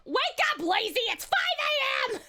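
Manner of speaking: hammed-up alarm yell; wake-up call. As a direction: nag a lazy sleeper awake.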